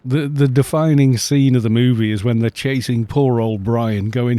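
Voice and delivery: normal talking voice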